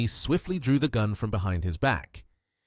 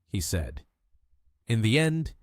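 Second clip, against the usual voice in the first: plain reading voice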